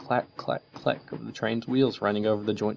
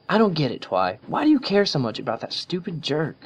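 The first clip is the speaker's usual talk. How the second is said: annoyed Rainbow Dash; 8 semitones higher, 4 dB louder than usual. A narrator's reading. Rainbow argues back.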